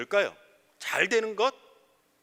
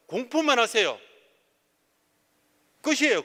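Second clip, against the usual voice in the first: raised, louder pulpit declaration; emphatic preaching; 6 semitones higher, 5 dB louder than usual. Real, not acted. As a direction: proclaim it firmly to the congregation.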